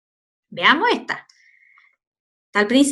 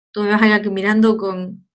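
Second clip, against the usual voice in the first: ordinary talk, nothing marked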